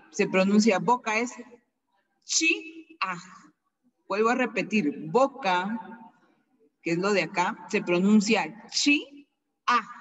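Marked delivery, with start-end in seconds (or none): 2.2-3.2 "chi-ah" with high "chi", break, dropped "ah"; apostrophe break. Shown as a demonstration. Teacher showing the apostrophe in mouth, "chi-ah" broken in two. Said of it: "vamos a mencionar fuerte". 8.7-9.9 "chi-ah" slow, with a stop between syllables; apostrophe break. Shown as a demonstration. Teacher repeating the word for mouth, slow broken "chi-ah". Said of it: "porque es apóstrofo".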